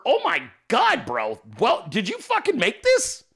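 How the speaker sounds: high, impressed disbelief; exaggerated awed reaction; mock amazement. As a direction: act blown away, pitch leaping up. Acts out impressed reaction to meme.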